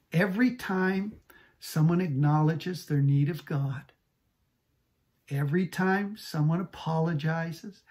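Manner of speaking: ordinary talk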